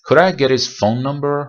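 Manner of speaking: slow, held n in phone number; slow model sentence. Shown as a demonstration. Teacher reading an example sentence slowly. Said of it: "چند تا مثال بزنیم".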